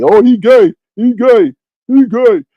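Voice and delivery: high, loud repeated accusation; mock gossips. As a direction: mimic gossips crying it out.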